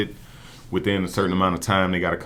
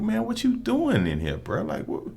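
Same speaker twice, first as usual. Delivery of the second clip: high incredulous scolding. Retold or imitated. To his old teammate in court, high disbelieving scold. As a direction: scold a friend in mock disbelief. Scolding friend caught on weed charge.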